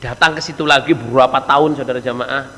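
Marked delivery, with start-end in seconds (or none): none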